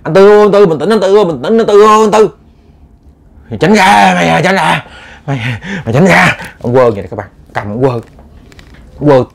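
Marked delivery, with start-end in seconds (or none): 0.0-2.3 high, loud pleading call; neighbors calling out. Retold or imitated. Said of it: "Người ta kêu ổng hoài". 3.5-6.4 possessed man yelling. Retold or imitated. Possessed man yells at people.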